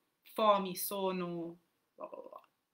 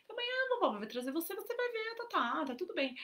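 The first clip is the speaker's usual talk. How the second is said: soothing; 11 semitones higher than usual. Retold or imitated. Mother soothing crying daughter.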